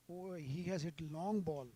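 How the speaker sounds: ordinary talk, nothing marked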